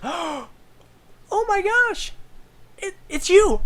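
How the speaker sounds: very high, loud excited squeal; starstruck bartender. Retold or imitated. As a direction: gush like meeting your heroes. Starstruck bartender recognizing the heroes.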